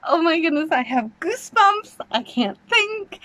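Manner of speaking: babble, too thrilled to think; giddy. Real, not acted. Goosebumps after trailer.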